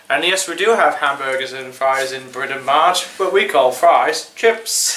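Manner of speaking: usual speaking voice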